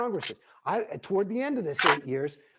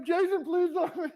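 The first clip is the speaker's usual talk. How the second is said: pleading mock voice; 10 semitones higher, slower than usual. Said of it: "you know".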